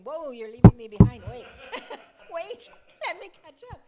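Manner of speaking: loud laughing mock protest; playful protest. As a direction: call out, laughing, to keep up. Mock protest at friend's zeal.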